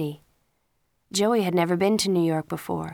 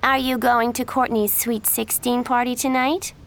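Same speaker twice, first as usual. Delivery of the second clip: high, louder catty greeting; fake-friendly girl voice; Miranda's greeting; 5 semitones higher, 4 dB louder than usual. A narrator's reading. Miranda sweetly greets her rival Jen, catty and loud.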